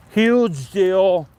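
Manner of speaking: a light, breezy take on Trump; hint of a Trump voice, punchy; mild Trump impersonation; Trump impression. Shown as a demonstration. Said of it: "That was my Trump impression".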